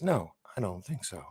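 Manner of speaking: quiet calm denial; flat muttered no. Retold or imitated. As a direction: say it low and flat, no heat. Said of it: "are you just saying that as".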